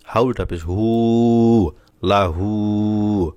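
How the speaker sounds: হু and লাহু stretched very slowly; drawn recitation. Shown as a demonstration. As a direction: pull the vowel long like tajweed. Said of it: "এখানে টান হবে".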